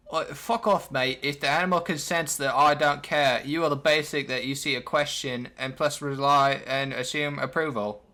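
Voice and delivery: bad Australian accent slipping into British; amateur mock Australian accent; shitty Australian accent. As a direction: do a clumsy Aussie that drifts British. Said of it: "That was my shitty Australian accent".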